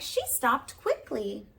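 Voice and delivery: plain reading voice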